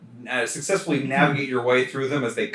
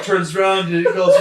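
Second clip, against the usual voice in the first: normal talking voice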